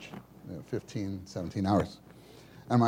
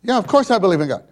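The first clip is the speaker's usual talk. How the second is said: annoyed; 9 semitones higher, 11 dB louder, faster than usual. Retold or imitated. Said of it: "now he's starting to get annoyed".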